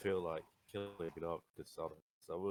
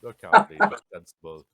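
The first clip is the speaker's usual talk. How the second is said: loud character retort; 17 dB louder than usual. Acted. Character answers the orc.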